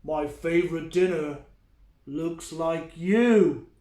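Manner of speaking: big, loud troll voice; grumpy troll. Acted. The troll menaces the goat, loud troll voice. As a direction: boom it out like a hungry troll.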